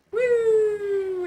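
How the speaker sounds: high, loud drawn-out "woo"; imitated brain flying away; playful whoosh. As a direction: send a long, high woo sailing up.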